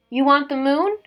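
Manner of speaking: usual speaking voice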